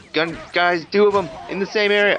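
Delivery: high, loud, rushed outburst; real gamer's high-pitched protest; exasperated alarm. Real, not acted. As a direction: cry out at the sudden ambush. Ambushed by enemies in game.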